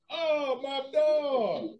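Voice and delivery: astonished exclamation. Real, not acted. Reacting to the movie scene.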